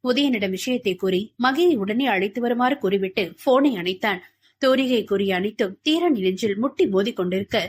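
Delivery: ordinary delivery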